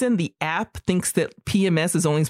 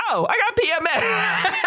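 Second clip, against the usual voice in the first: sudden realization; 14 semitones higher than usual. Retold or imitated.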